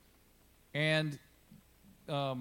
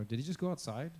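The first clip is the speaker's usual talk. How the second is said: normal talking voice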